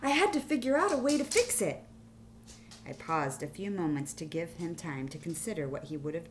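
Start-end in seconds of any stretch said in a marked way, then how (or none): 0.0-1.8 loud, high mock despair; dramatic crisis. A narrator's reading. Teacher dramatizes wall mistake to student, loud and high. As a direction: play up the disaster for the kids. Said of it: "dramatically to stress the extent of my crisis".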